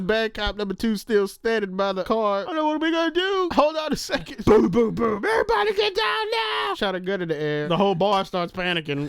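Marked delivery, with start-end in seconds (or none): 2.5-6.7 high panicked shouting. Retold or imitated. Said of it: "acting the movie scene".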